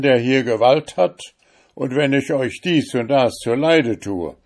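loud, high, domineering threats; threatening judge. Acted. Richter droht dem Fremden. (laut drohender Richter)

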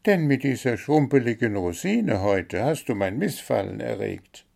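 high, chirpy bird voice listing its complaints; little blue bird, demanding. A narrator's reading.

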